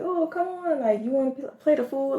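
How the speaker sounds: lightly acted neighborly coaxing; casual street invitation. Voicing friendly locals inviting a passerby.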